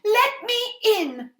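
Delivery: high, loud and slow call at the door. Acted. Mother at the witch's door, calling out loud. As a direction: pound on the door with your voice. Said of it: "the mother called".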